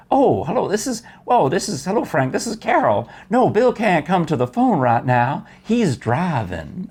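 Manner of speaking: high, lilting Southern Virginia lady; Southern Virginia accent. Retold or imitated. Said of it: "Southern Virginia accent".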